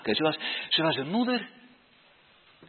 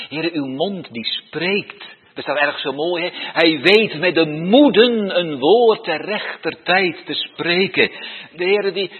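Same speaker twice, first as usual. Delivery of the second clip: loud preaching; 10 dB louder than usual. Acted. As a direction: proclaim it loud and full. Preacher proclaims loudly. (luid, vurig preken)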